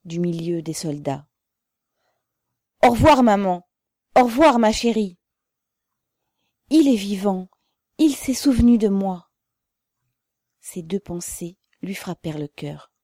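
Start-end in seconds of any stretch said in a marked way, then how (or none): 2.8-5.2 loud, high farewell shout; clear-voiced call. A narrator's reading. Pavel calls to mother among soldiers, clear loud call. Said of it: "La voix nette de Pavel lui répondit". 6.7-9.3 loud, raised exclamation; joyful relief. A narrator's reading. Mother hears her son is alive, joyful loud exclamation.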